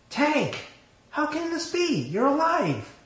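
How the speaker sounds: high, loud disbelief, almost shrieking; shocked joyful cry. A narrator's reading. Wife seeing her lost husband alive, high shocked cry.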